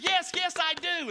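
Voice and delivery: high, loud, excited assent; imitated spectator bursting with belief; eager shouting. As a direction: shout back like a convinced fan. Acted fan eagerly shouting back.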